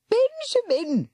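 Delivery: high squeaky startled cry of his name; squeak. A narrator's reading. Old lady squeaking at the boy. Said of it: "squeaked Miss Weathers".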